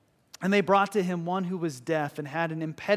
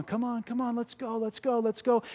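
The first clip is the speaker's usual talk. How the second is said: encouraging coach; 6 semitones higher than usual. Retold or imitated. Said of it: "your teacher said".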